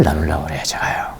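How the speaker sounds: breathy aside to the congregation; whisper. Real, not acted. Preacher whispering his plan to the congregation. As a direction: lean in and whisper it.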